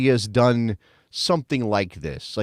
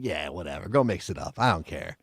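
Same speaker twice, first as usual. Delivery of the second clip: quiet, lower shrug-off with a bored tone; indifferent Weston; 8 semitones lower, 8 dB quieter than usual. Retold or imitated. Host voicing Weston shrugging off the troll, quiet and indifferent. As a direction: toss it away like you couldn't care less. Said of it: "he's kinda like".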